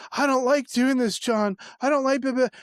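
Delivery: high-pitched imitation of tearful pleading; mock weeping. Man on his knees pleading to John, mock weeping. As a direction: whine it like someone crying, half-acted. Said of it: "and just weeping".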